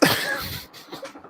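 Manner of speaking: real, helpless cracking up; breathy, wheezing laugh; burst of laughter. Real, not acted. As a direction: burst out laughing at your own reveal. Cracks up revealing the comment.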